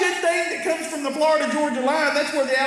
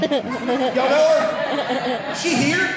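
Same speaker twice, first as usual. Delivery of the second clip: slow teasing; much slower than usual. Acted. Slow teasing about Karen.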